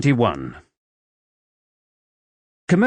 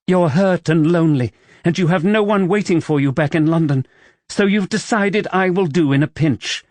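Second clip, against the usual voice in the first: higher female character voice; woman's voice; 7 semitones higher than usual. Acted. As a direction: voice the heroine, hurt and accusing. Camilla's voice, female figure.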